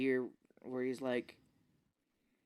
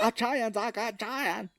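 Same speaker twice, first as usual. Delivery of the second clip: higher, strained mock Irish accent; a struggling actor fighting his Irish accent; lispy mock Irish accent through bad teeth; 9 semitones higher than usual. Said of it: "supposed to have an Irish accent".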